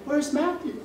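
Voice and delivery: a light imitation of a worried grandma; grandma asking. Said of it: "my grandma's like".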